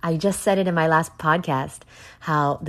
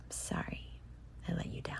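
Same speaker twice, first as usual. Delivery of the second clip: much softer, breathy sorry after a sigh; sighing whisper apology; 21 dB quieter, faster than usual. Real, not acted. Podcaster apologizing to Sherry for cursing, sighing whisper. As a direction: let the apology fall away in a sigh.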